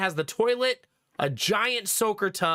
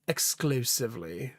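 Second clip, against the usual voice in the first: lower, slow affected voice; put-on voice; 6 semitones lower, 4 dB quieter than usual. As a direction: drop into a low, drawn-out put-on voice. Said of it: "Is that how you talk, sir?".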